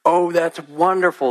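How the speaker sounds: put-on high, loud delighted old man. Old man to the drunk, high and delighted. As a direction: gush with joy. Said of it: "the old man said".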